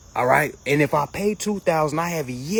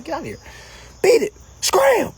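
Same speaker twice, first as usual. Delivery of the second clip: shooing away; 4 dB louder than usual. Real, not acted.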